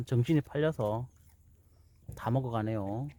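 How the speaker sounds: usual speaking voice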